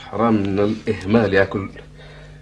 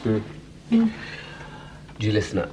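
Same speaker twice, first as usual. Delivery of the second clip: ordinary delivery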